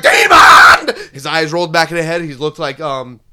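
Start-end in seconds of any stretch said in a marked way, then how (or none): 0.0-1.0 very high, loud cry of demon; hammed-up horrified priest; mock priest shout. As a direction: scream the word like a frightened exorcist.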